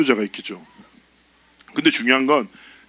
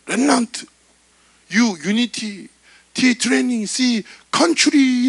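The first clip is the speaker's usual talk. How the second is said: overexcited worship leader; 10 semitones higher, slower than usual. Retold or imitated. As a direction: ham up a leader shouting the acronym. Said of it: "진짜 인도자가 외쳤어요".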